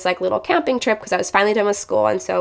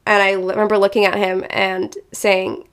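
ordinary talk, nothing marked

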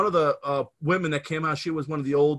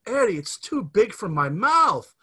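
put-on high, slow, breathy-free coy girl voice, louder; girl in the commercial; 5 semitones higher, 5 dB louder, slower than usual. Man retelling radio ad, voicing the girl's coy plea. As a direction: play the flustered girl in the ad, drawn out. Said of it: "you hear a girl say".

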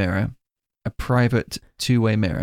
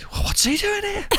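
high, slow, put-on voice of a baffled friend; mock baffled reaction; slower than usual. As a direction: pitch it way up and drag it out in disbelief. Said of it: "And I'm sure you were like".

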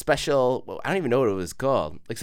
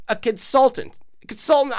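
high-pitched mocking echo; 9 semitones higher than usual. Comedian scoffing at stranger's job, high mock echo. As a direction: parrot the job title in a high voice.

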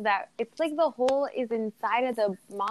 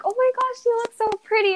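much higher, gushing excited voice; excited compliment; 13 semitones higher, 4 dB louder than usual. Retold or imitated. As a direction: gush it in a high, excited pitch. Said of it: "that's the voice I do when I get excited".

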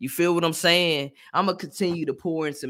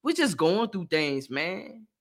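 normal talking voice

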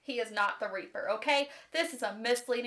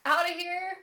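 higher, louder retold outburst; mock angry cussing; 6 semitones higher, 5 dB louder than usual. Locked-in captor cusses out the princess, mock angry. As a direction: imitate a caged man's indignant demand. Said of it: "cussing her the fuck out".

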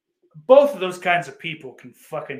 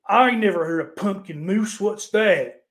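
put-on dumb southern accent; 5 semitones higher, 6 dB louder than usual. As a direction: play a dim Southern customer, lightly. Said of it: "when I use a southern accent".

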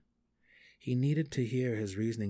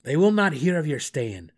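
loud, higher-pitched gnome yell; raised-voice scolding; 6 semitones higher, 12 dB louder than usual. A narrator's reading. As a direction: yell it in exasperation. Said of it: "Belwer yelled at him".

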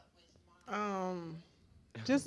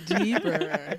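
giggling through the word; laughing; 11 semitones higher, 10 dB louder than usual. Real, not acted. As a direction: laugh while saying it. Echoing the risqué word, laughing.

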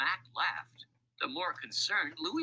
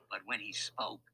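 ordinary delivery, nothing marked

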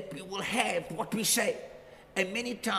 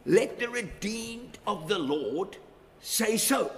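1 slow scripture proclamation; slower than usual. Acted. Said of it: "The Bible says in Psalm".

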